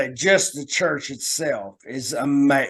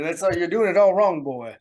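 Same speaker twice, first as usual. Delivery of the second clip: blustery Southern cartoon rooster; Foghorn Leghorn; 2 semitones higher than usual. Host shows the proper Southern charm, cartoon rooster voice. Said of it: "Foghorn Leghorn boy".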